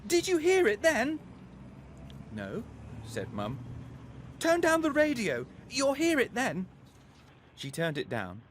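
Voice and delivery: put-on a boy pleading to be believed; boy insisting his mum hears the bang; excited boy. A narrator's reading. Abdu urgently insists.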